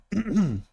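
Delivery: rough throat-clearing cough. Real, not acted. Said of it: "sorry, I'm coughing".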